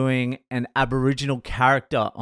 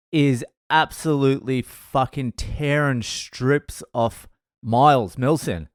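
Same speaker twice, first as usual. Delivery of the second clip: ordinary talk, nothing marked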